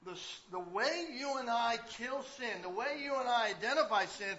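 loud, slow, emphatic preaching; fiery pastor pressing the congregation; preaching at full force. Acted. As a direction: drive the point home from the pulpit.

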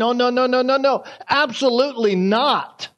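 mock insistent no, no, no; a lightly voiced mother waving it off; a hint of an emphatic refusal. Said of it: "It's almost like she's saying".

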